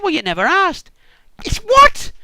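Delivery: very high, loud disbelieving shriek; incredulous squeal. Acted. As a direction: squeak with outrage. Laban's glib reply, Jacob's squeal.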